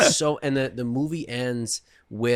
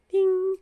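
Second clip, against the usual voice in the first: high ding. Retold or imitated. Imitating the light's signal.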